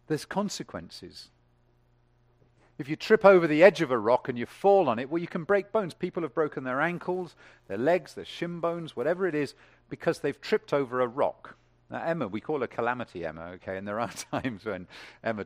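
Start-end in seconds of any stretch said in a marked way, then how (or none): 2.8-11.6 much higher and louder, ringing out marveled; full-force preaching. Acted. Preacher exulting to the congregation, full-force. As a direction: preach it out to the rafters.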